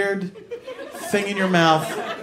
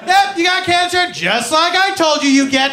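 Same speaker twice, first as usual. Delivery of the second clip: smug doctor; 8 semitones higher, 5 dB louder than usual. Retold or imitated. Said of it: "They're like".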